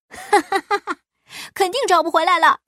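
loud, high laughing taunt; rowdy onlooker's mocking cackle and quick jeer; jeering crowd. A narrator's reading. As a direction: laugh out loud, then heckle fast and high. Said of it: "百姓们在一旁起哄".

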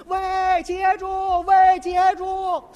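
high loud call, the last one stretched slow; girl shouting after a fleeing cyclist. Retold or imitated. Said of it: "那姑娘冲他直喊".

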